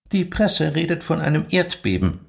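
high, cool and formal rebuke; stern school head demanding silence; strict headmistress. Acted. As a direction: put the eager trainee in her place. Headmistress briefing.

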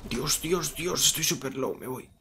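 lamento agudo y exagerado; quejándose de tener poca vida; quejido agudo. Real, not acted. Low on health mid-fight. (quejido exagerado)

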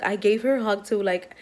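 ordinary talk

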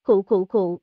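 harsh wounded coughing fit; pained cough. Acted. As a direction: cough like you're hurt inside. Wounded man coughing blood. (ho sặc sụa vì bị thương)

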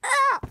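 high loud yelp of pain. Acted. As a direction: cry out in pain. Hurt, cry heard.

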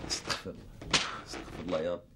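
husband whispering for patience mid-quarrel; whispered prayer. Acted. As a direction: whisper it to calm yourself down. Husband seeks patience, muttering.

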